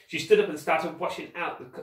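plain reading voice

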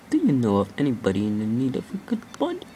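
quick, odd put-on voice for the headless snowman; silly character voice. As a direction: rush it like a cartoon snowman begging. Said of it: "Why am I talking like this?".

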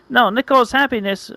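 high-pitched mock distress; hammed-up worry; acted dismay. Distress at foreseen ruined wedding.

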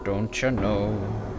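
hushed and eerie, almost breathed; quiet. Acted. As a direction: murmur it like a haunted echo. Echoing the game's haunting line.

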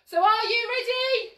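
ordinary talk

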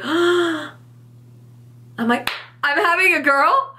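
high, gasping disbelief; stunned realization. Retold or imitated. Mum realizing she is having a girl, in high gasping disbelief. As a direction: let it dawn on you with a gasp.

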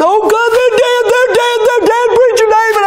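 imitating a terrified boy crying out; panicked crying scream. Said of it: "Tristan screamed and crying".

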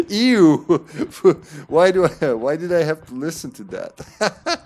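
laughing ew in disgust; giggling, grossed out; disgusted laughter. Real, not acted.

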